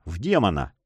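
usual speaking voice

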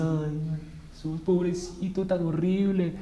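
soft, pitying whimper of a sentimental viewer; mock weepy moviegoer. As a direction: mimic someone pitying poor Jesus, softly. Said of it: "La gente ve la película de Jesús y comienza". (imitación suave y lastimera de un espectador sentimental)

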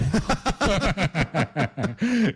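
a short scornful laugh; mocking laugh. Prisoner laughing at his cellmate with scorn.

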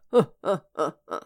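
sinister low cackle; villain laugh. A narrator's reading. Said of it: "зловеще захохотал кот".

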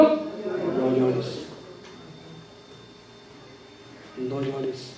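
hushed, gentle Jesus consoling a widow; tender comfort. Acted. Jesus softly tells the grieving widow, hushed and tender. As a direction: soften it right down, like soothing someone in tears.

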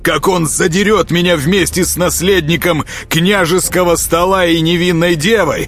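high, impassioned tirade; indignant challenge. Acted. Bound prince daring the traitor to watch, indignant.

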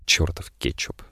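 whispered grumble to himself; muttered under breath. Acted. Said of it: "пробормотав себе под нос".

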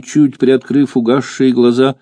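plain reading voice

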